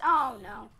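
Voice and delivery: loud sudden oh no; real alarmed cry. Real, not acted. Player ambushed by mobs, crying out. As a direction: gasp it as danger hits.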